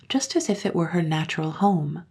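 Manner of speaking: usual speaking voice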